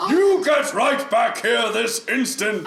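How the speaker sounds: high, slow, loud scolding shout; yelling into the well. Acted. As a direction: order the runaway back like an angry parent. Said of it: "I yell into the well".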